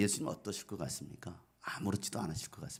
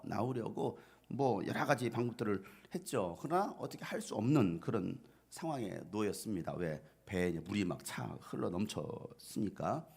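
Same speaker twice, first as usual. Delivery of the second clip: ordinary talk, nothing marked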